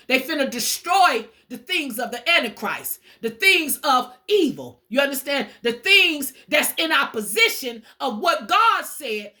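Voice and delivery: ordinary talk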